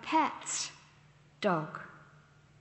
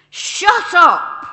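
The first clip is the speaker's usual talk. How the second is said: furious shouted "Shut up," much louder and higher; angry shout; 9 semitones higher, 18 dB louder than usual. Shown as a demonstration. Preacher shows the congregation an angry "shut up", shouting. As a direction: bark it out, truly angry. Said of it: "It's pretty clear I'm angry".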